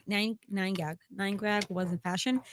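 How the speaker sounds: usual speaking voice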